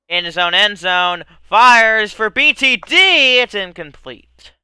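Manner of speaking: slow, building sports call; play-by-play. Acted. Mock play-by-play of the game.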